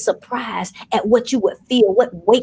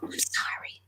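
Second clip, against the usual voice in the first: whisper; 16 dB quieter than usual. Acted. Whispered apology in sermon.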